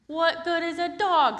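high, loud, exasperated question; exasperated Peter. Acted. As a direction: squeak in disbelief. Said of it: "said Peter".

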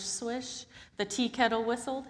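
plain reading voice

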